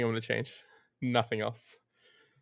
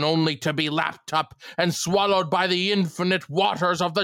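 Hark monologue; slower than usual. Acted. Keeper damning young Winslow, slow theatrical roar. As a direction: declaim it like a raving old sea dog. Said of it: "the entire Hark speech".

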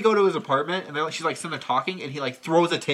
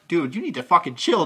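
usual speaking voice

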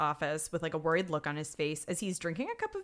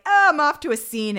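high, very loud imitation of a detective calling out; oblivious call-out; 8 semitones higher, 12 dB louder than usual. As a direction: call it out breezily, playing the clueless detective. Stabler unaware, calls out to boss.

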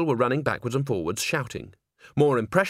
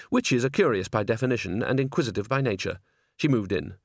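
ordinary delivery, nothing marked

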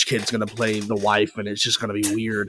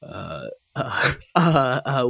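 ordinary talk

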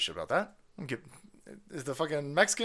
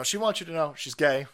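ordinary delivery

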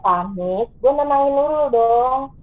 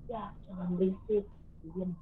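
much softer, low ghostly warning; ghostly hush; 5 semitones lower, 14 dB quieter than usual. Retold or imitated. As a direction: hush them from the dark like an unseen voice. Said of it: "ada yang ngomong".

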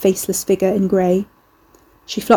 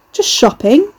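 high, raised voice for onlookers; louder and higher, playing to the guards; loud airy reply; 7 semitones higher, 5 dB louder, slower than usual. A narrator's reading. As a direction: toss it loudly over your shoulder. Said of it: "he replied loudly over his shoulder".

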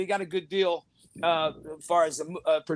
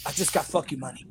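imitating Matthew; 5 semitones lower, 6 dB quieter, faster than usual. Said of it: "And he goes".

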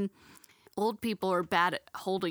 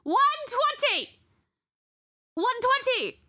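shouting; 3 dB louder than usual. Retold or imitated. Bank customer yelling to everyone in the bank, imitated shouting. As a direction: holler it, imitating a frustrated customer. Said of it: "Shouting and pointing at me".

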